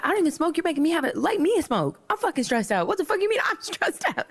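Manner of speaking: high-pitched mock outrage; exasperated retort. Woman retorting to his stressed-out complaint, high exasperated outburst. Acted outraged comeback in quarrel.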